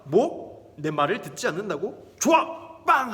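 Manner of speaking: sudden outraged burst ending in a loud bang; impulsive angry king. Acted. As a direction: snap into a rash, loud decision. King angrily stamps decree.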